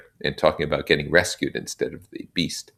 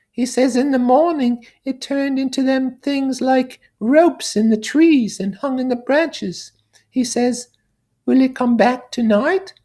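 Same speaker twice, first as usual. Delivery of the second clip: put-on high, childlike voice, much higher and louder; piping kid relaying a small boy's fear; little boy's go-between; 8 dB louder than usual. Piggy passing on the little boy's question in a high childlike voice.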